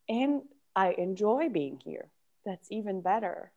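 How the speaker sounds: hushed quiet acceptance. Acted. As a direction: say it quietly to yourself. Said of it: "shift completely your voice and your character".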